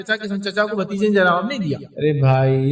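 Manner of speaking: usual speaking voice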